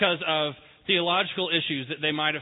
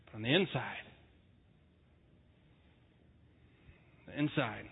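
hushed emphasis; 6 semitones lower, 7 dB quieter than usual. Real, not acted. Sermon turns to inner hurt.

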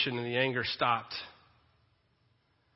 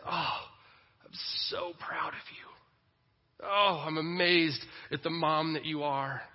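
loving Father God; 3 semitones higher than usual. Acted. God looking down on his children, proud and amazed. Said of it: "That's the voice of God who looks down and says".

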